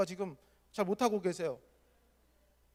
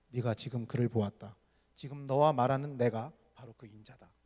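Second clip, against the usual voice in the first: lower, softer and tender; gentle dialogue; 6 semitones lower, 3 dB quieter than usual. Retold or imitated. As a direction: speak it gently, reverent. 예수와 맹인의 조용한 대화. (낮고 부드럽게 경건하게)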